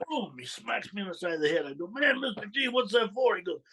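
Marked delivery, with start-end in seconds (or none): 1.9-3.4 put-on high, startled kid asking why he was hit; startled protest. Startled teen to mob boss after smack, high protest. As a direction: yelp of surprise from a teenager just smacked by his boss.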